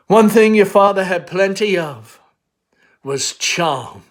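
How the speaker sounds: brushing off her daughter's fear; Amanda, brisk mother. Acted. Mother scolding daughter for calling herself crippled, insistent.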